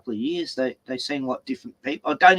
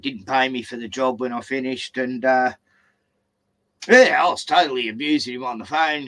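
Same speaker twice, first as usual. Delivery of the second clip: usual speaking voice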